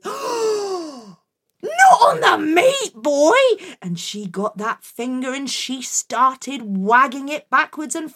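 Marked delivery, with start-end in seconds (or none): 0.0-3.4 high, slow angry mother shout. Acted. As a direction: yell it out, furious. Mum shouting.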